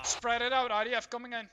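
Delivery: loud warning over incoming fire; urgent shout. Real, not acted. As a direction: yell it to the squad.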